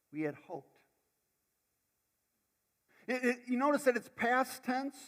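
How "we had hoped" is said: low, soft, heartbroken "We had hoped."; from deep in the heart. Retold or imitated. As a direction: sigh out "We had hoped" as lost hope. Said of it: "from deep in their hearts".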